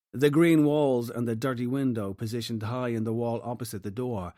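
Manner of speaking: ordinary delivery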